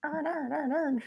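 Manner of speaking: high, squeezed Baltimore accent imitation; trying out the Baltimore "earn" sound. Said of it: "Aaron earns an iron iron".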